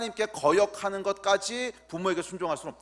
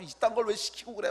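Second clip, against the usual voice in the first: mock sulky whine, like a child pouting; pouting complaint; 5 semitones higher than usual. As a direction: whine it like a pouting kid told to do chores. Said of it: "입술이 이만큼 나와가지고".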